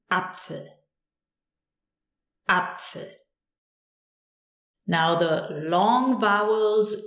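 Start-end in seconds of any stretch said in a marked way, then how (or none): 0.1-0.7 Apfel with a short, quick A. Shown as a demonstration. Teacher showing learners the short A, Apfel with A cut short. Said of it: "a short one as in". 2.5-3.1 Apfel with a short, quick A. Shown as a demonstration. Teacher showing learners the short A, Apfel with A cut short. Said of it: "a short one as in".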